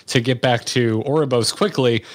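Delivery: ordinary talk, nothing marked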